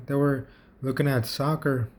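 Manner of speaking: ordinary delivery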